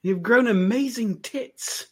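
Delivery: high-pitched, hammed-up movie servant; gushing servant. Servant greets long-gone master in film quote, gushing high voice.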